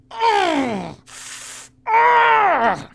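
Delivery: high loud yelp of pain; two long, high-pitched pained shrieks; pained cry. Acted. Dwarf whose arm is being twisted, high pained cries. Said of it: "and he goes".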